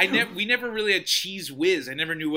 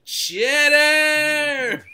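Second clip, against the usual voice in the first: high, loud shout; excited shout; 10 semitones higher, 8 dB louder than usual. Retold or imitated. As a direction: shout it out with glee.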